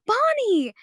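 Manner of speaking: high retold call of a name, with relief; mock joyful cry. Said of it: "Marcy turns and goes".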